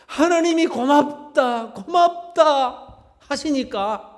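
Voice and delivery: forceful preaching. Real, not acted. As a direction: drive the message home to the congregation.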